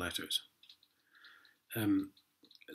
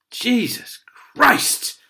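loud, much higher, slow cry of disbelief; exasperated shout; 10 semitones higher, 12 dB louder, much slower than usual. Real, not acted. Man reading the court letter shouts in disbelief. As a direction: throw it out in pure exasperation.

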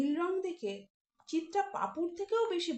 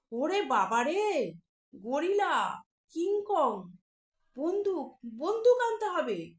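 loud frightened cry, rising to a yell; panicked shout; 2 semitones higher, 3 dB louder, slower than usual. Acted. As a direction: shout in terror as you turn to run. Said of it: "চিৎকার করে উঠল".

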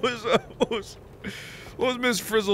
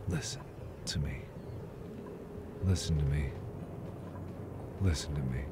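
very low, soft plea for attention; quiet, deadpan insistence; hushed confiding; 16 dB quieter, faster than usual. Acted. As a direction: murmur it as if sharing a bad idea. Quietly prefacing a stupid idea.

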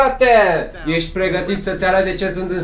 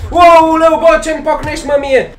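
loud, higher-pitched hurt outcry; shocked protest; 5 semitones higher, 4 dB louder than usual. Real, not acted. Boxer complaining to the opponent who punched him, shocked loud protest. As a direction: cry out in indignant surprise.

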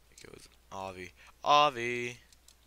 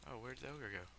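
ordinary talk, nothing marked

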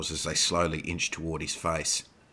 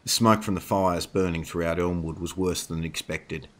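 ordinary delivery

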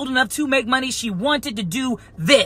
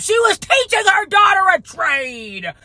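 derisive imitation, pitched up; mocking commenter; 6 semitones higher than usual. Mocking commenters' excuse in a rant.

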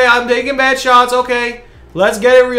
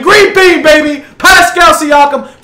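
higher, louder hype call; celebrating shout; 4 semitones higher, 6 dB louder than usual. Real, not acted. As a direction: cheer the shot going in. Celebrating a made shot.

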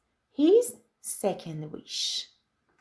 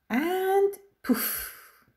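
excited; 9 semitones higher than usual. Acted. Magic wish granted.

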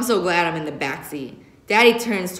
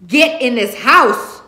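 loud, slow, higher angry mother's shout; 5 semitones higher, 9 dB louder, slower than usual. Acted. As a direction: order them inside. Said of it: "she shouts".

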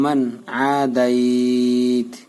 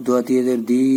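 ordinary delivery

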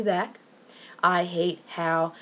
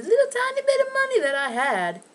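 high, squeaky whine; mock-tiny squeal; 5 dB louder than usual. Real, not acted. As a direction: pitch way up to belittle the amount. Griping about lost small money.

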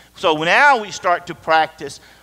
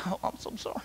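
mock whispered apology; 15 dB quieter, faster than usual. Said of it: "But repentance isn't just".

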